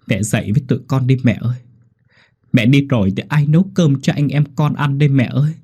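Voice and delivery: lightly voiced boy pleading for his mother; understated tearful plea at the grave; mild child's lament. A narrator's reading. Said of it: "mà than khóc". (giọng than khóc nhẹ của đứa trẻ)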